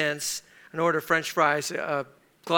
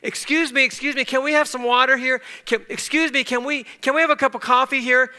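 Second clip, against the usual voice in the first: raised, higher-pitched plea for attention; calling out; 8 semitones higher, 5 dB louder than usual. Retold or imitated. Ignored teenager trying to get a server's attention, calling out. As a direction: call out politely but insistently to a server. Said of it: "we would call out".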